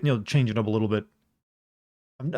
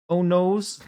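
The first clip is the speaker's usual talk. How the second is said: uwu speak; 5 semitones higher than usual. Shown as a demonstration. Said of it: "Uwu speak".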